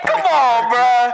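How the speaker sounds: mock exasperation; exasperated come on. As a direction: beg him to quit it, fed up. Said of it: "You're like".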